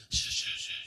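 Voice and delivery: rhythmic run of unvoiced shushes; soothing shush. Mother hushing her crying baby, soothing shush. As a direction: hush the baby in repeated short bursts. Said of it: "Y la mamá".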